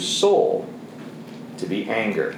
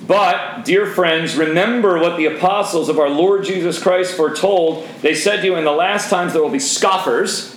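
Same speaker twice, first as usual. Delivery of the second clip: raised, measured Bible reading; scripture reading; 5 semitones higher than usual. Acted. Preacher reads scripture to his men's group, raised and measured. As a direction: read the verse out to the room.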